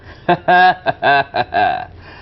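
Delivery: loud signature movie-star laugh; booming filmy laugh; Rajinikanth laugh. Acted. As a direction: throw out the trademark laugh. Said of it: "Rajinikanth గారిదండి".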